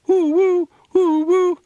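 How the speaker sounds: high, loud "woo woo" like a train; train whistle imitation. A narrator's reading. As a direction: hoot like an oncoming train's whistle. Sister mimics the oncoming train.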